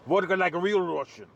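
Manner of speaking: imitated Russian accent, emphatic on vodka. Showing how Russian friends say vodka, imitated Russian accent. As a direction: say it like a Russian drinking buddy, accent and all. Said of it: "That's how they say it".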